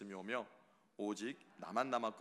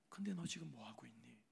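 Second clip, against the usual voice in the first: hushed, intimate whisper; whispering God's question to the listener; 6 dB quieter than usual. Acted. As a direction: breathe the question gently. God's question voiced softly.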